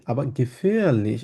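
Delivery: aber gefährlich drawn out slowly; slowed teaching example; slow rhythm demo. Shown as a demonstration. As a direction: stretch the words aber gefährlich. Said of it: "এটাকে সে একটু slowly বলেছে".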